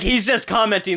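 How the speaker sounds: usual speaking voice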